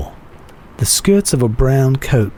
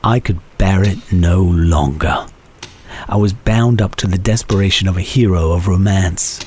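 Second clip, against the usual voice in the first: usual speaking voice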